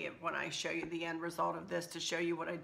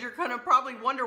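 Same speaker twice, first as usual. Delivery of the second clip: normal talking voice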